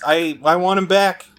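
ordinary talk, nothing marked

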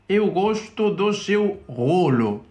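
hammed-up Portuguese, drawn out and high; Joao Félix. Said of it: "voy a pensar en Joao Félix, como lo diría".

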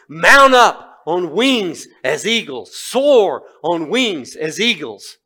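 slow, loud, high declaiming; proclaimed scripture. Acted. Preacher recalling the eagle verse to congregation, slow loud proclamation. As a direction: ring the verse out slowly. Verse recited in his mind.

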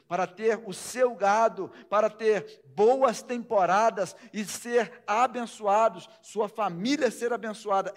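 loud, rising sermon; preaching at full force. Acted. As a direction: preach it out with fire. (pregação inflamada)

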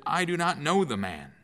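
an oath-bound no; sworn denial. Acted. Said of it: "denied it with an oath".

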